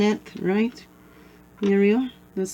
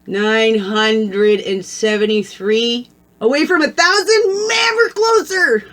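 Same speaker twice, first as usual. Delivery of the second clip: excited shout; 3 semitones higher, 5 dB louder, slower than usual. Real, not acted. Vlogger cheering the subscriber count toward a thousand, shouting happily. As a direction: cheer as if the goal is almost reached.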